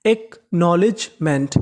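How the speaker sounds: Acknowledgement slowly, split as एक - knowledge - meant; syllable by syllable. Shown as a demonstration. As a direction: spell out the sound of acknowledgement in parts. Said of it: "तो पूरी meaning क्या बनी?". (Acknowledgement को हिस्सों में धीरे)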